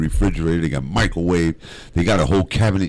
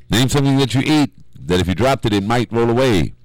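game show question read; 5 semitones higher than usual. Acted. Host reading the Family Feud question to the callers, announcer style.

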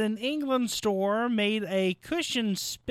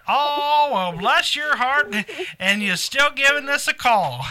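sympathetic; 6 semitones higher, 7 dB louder than usual. Real, not acted. Host sympathizes with caller.

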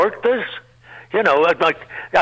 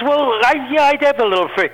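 flustered reply; 8 semitones higher than usual. Retold or imitated. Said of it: "I said".

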